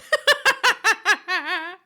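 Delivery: high, loud burst of laughter. Real, not acted. After making the scammer depressed.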